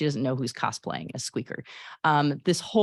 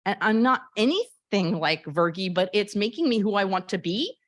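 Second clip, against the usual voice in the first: put-on higher, louder defiant girl voice; Honey, defiant teen; 6 semitones higher, 3 dB louder than usual. Honey tells Squeaker who cares who laughs, defiant. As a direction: lift up, claiming who she is. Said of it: "pretend I can act".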